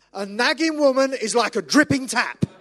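high, loud proud reading aloud. Retold or imitated. Son reading the wrong proverb aloud, loud and high. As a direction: read the verse out with a flourish. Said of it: "And he goes".

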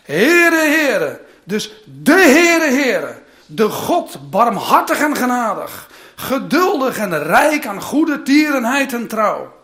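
rereading the verse to the congregation, fervent; emphatic reread. Shown as a demonstration. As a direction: hammer home each word of the name. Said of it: "ik ga het nog een keer voorlezen".

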